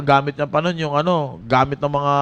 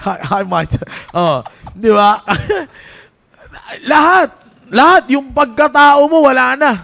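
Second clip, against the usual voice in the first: raised, urgent preaching to the youth; 4 semitones higher, 4 dB louder than usual. Acted. Youth pastor exhorting Team Focus, preaching with force. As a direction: drive it home to the young people.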